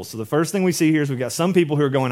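normal talking voice

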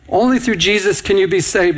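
normal talking voice

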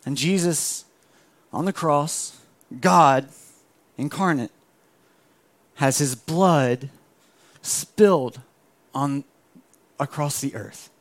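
low, soft and solemn preaching; hushed sermon. Acted.

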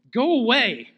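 put-on high, loud whiny toddler demon; childish demon. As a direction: sulk it out like a bratty kid. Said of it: "the demon maybe had the maturity level of a toddler".

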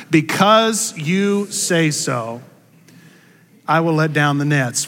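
slow, drawn-out congregational recital; slow call-and-response. Shown as a demonstration. Pastor leading the congregation in a slow, unison recital. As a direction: lead the room through it slowly, word by word. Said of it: "practice that with me".

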